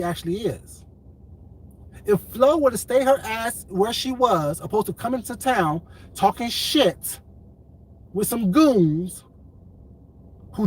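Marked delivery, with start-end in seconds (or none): none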